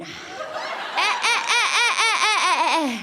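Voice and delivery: loud, high staccato "eh-eh-eh" laugh; big self-satisfied Frenchman laugh; mock French laugh. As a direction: cackle smugly like a cartoon Frenchman, loud and high. Mock Frenchman laughing at his boast.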